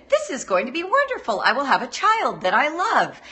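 high, quick, dreamy gush; gushing expectant mother. Acted. Expectant mother picturing her baby, gushing with excitement. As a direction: gush it with swooping delight. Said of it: "just like when you're pregnant".

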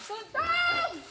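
very high, drawn-out call; high-pitched cry. Pretend. Playing an imitation game with friends, high-pitched cry. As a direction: send the name way up high.

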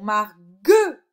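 slow "Marguerite" with a loud second syllable; syllable stress. Shown as a demonstration. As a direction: punch the "gue" in "Marguerite". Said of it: "sur le deuxième syllabe". (« Marguerite » découpé, deuxième syllabe appuyée)

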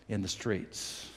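ordinary talk

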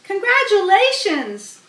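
high excited shout. Acted. Wilbur cheering the goose, excited shout. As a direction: cheer it out like an eager pig. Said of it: "shouted Wilbur".

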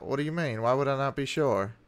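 ordinary talk

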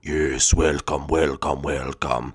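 very low, slow boss voice; echoing doom voice of a giant head; deep booming giant head. Acted. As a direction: rumble it like a giant floating head.

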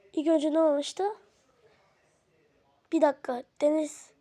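ordinary talk, nothing marked

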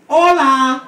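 loud high Hola for the crowd to copy; teacher's call. Shown as a demonstration. Drag queen teaching the crowd Spanglish, loud and high. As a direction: call it out clearly to be repeated. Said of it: "Repeat after me".

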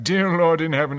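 loud, high cry of relief; joyful exclamation. A narrator's reading. Mariner exclaiming joy at the rescue boat. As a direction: cry it out to heaven.

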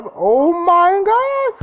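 real panicked scream; terrified scream. Real, not acted. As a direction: shriek in real panic as it strikes. Sudden fright in game.

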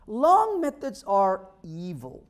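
long methods are evil said slowly with weight; slow proclamation. Acted. Speaker warns the audience, slow and weighty. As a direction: pronounce it like a verdict.

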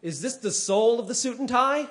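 ordinary delivery

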